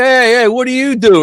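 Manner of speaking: loud, high mocking hail; loud call-out. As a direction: call out to him like a cocky jock. Bullies call out at reunion.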